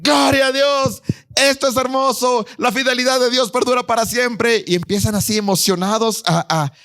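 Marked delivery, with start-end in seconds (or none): 0.0-4.6 high, jubilant cheer that lifts into song; young people shouting praise at the rebuilt temple, overjoyed; festive joy. Acted. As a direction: celebrate the new temple, nearly singing. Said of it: "se ponen a festejar".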